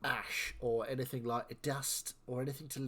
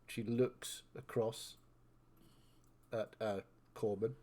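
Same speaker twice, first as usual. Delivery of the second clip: ordinary talk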